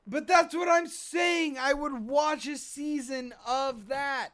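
loud, high-pitched fan pleading; worked-up insistence. Real, not acted. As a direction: burst out insisting on your point. Excited fan arguing the point.

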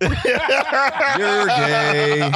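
high, drawn-out taunt; mocking jeer. Real, not acted. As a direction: stretch it out as a teasing singsong. Teasing jeer at the story's writer.

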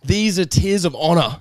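slow, grand, laughing declaration; hammed-up noble pride, slowed down; mock solemn. A crying boy telling the bully his tears are noble, mock solemn and slow. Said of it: "I pointed to my face and said".

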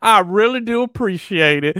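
high, drawn-out Southern drawl; mock Southern accent. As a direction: lay on a syrupy, hammed-up Southern accent. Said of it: "would change my accent".

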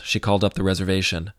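plain reading voice

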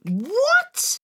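high, loud disbelieving yelp; surprised. Real, not acted. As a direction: gasp it out in disbelief. Shocked at the pirate fact.

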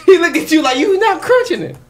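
laughing. Real, not acted. Mocking friend swallowing ice.

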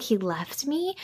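small, soft, hurt question; retold devastation. Daughter told that Devlin left, softly mock-devastated. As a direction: quietly voice a crushed daughter, ending on a rise. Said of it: "she's kind of devastated".

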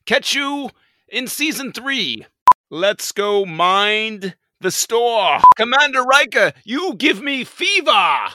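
high, slow hammed-up captain; late season Picard. Host's late season Picard bidding crew farewell. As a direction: camp it up as Picard. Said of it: "my late season Picard".

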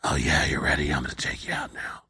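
whispered low movie tough guy; hushed action-hero whisper, low and menacing; Kurt Russell impression. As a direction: breathe the threat like a film hero. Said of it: "where Kurt Russell's like".

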